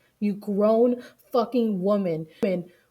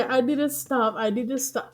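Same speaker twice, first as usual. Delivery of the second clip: ordinary talk, nothing marked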